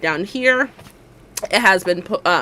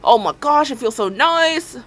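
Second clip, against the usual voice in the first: ordinary delivery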